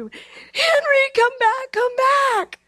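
high, loud call down the slope. Retold or imitated. Wife calling her husband back up the ravine, loud high call.

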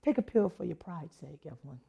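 very soft, matter-of-fact reply; quiet voice of God. Retold or imitated. God answering the woman who wanted healing, quiet correction. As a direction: imitate God gently putting her straight, hushed. Does God really talk like that?.

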